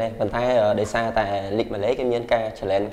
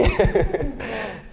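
high-pitched real laugh; 11 semitones higher than usual. Real, not acted. Player answering whether he loves his fans, laughing. Amused while answering fan question.

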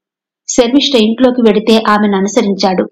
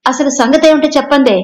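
impatient snap, pitched higher; an exasperated demand, cut to the point; irritated; 5 semitones higher than usual. A narrator's reading. Master demanding the truth from the maid, irritated and higher. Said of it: "అన్నాడు విసుగ్గా".

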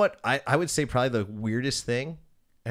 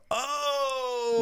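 long, high crowd "ohhh"; imitated crowd "oh". DJ mimics the room reacting, high drawn-out "oh". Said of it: "you can hear 'em go".

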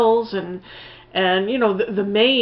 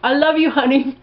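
higher, sing-song mock of the sappy couple; a lightly hammed-up parody of endless I-love-yous; teasing the couple's constant sweet talk, higher and louder; mock lovey-dovey; 5 semitones higher, 3 dB louder than usual. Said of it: "sum up this movie with one gesture or one sound".

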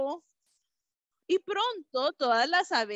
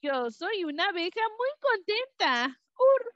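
high bright bee voice; happy bee voice; 7 semitones higher than usual. A narrator's reading. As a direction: cheer like a tiny bee.

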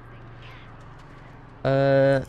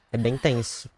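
ordinary delivery

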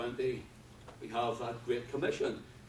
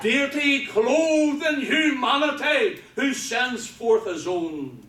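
loud, high-pitched preacher's cry; preaching at full force; 9 semitones higher, 14 dB louder, slower than usual. Real, not acted. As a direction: proclaim it at full volume to the congregation.

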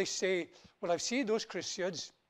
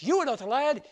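loud, higher roaring lion; lion's roar; 7 semitones higher, 10 dB louder than usual. Acted. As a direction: roar it at him. Lion roars at Tumba.

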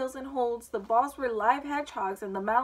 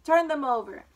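loud, higher-pitched angry command; angry order; 4 semitones higher, 8 dB louder than usual. A narrator's reading. Queen to the knave about the gardeners, angrily. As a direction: order it sharply in a raised voice. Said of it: "The queen turned angrily".

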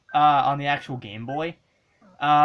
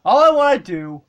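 loud cheer; 11 semitones higher, 10 dB louder than usual. Real, not acted. Celebrating score.

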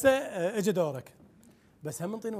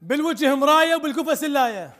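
loud, unaware of own volume; too loud, headphones on; 6 semitones higher, 6 dB louder than usual. Real, not acted. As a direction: shout over music in your ears. Guesser now wearing headphones.

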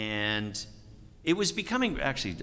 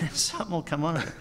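normal talking voice

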